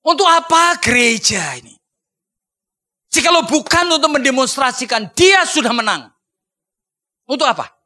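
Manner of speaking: loud fervent preaching. Acted. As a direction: proclaim it with fire. Preaching climax.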